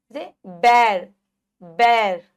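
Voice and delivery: बैर loud, mouth wide; wide open ai. Shown as a demonstration. Teacher modelling बैर for children. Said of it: "बड़ा मुंह खुल जाएगा".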